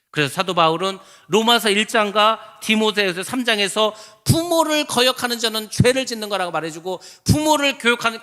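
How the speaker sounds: loud, emphatic sermon delivery; full-force preaching. Acted. Pastor preaching to the congregation about children honoring parents, forceful preaching.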